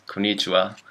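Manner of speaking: konnichiwa with English stress, kuh-NEE-chiwa; English-accented misreading. Retold or imitated. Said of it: "possibly with accent".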